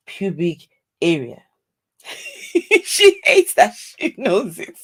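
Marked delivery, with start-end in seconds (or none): 2.0-4.8 slow words squeezed out through laughter; laughing. Real, not acted. Reacting to the fupa definition, laughing hard. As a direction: giggle your way through the line.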